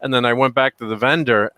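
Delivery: ordinary talk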